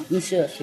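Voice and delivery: MONSIEUR as a quick "muh-syuh", no N or R; French monsieur. Shown as a demonstration. Teaching how to address a waiter, French monsieur. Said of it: "you have to say".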